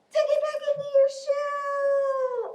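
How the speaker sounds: very high, squeaky little voice; tiny turtle voice. Pretend. Teacher's last turn tucking turtles in, tiny high voice. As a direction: sing it out high, stretching the last word.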